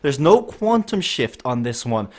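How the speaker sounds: excited commentator building to a sprint finish; live race play-by-play. Real, not acted. Commentator calls Badger's sprint for the line, excited play-by-play.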